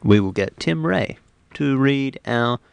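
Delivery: ordinary talk, nothing marked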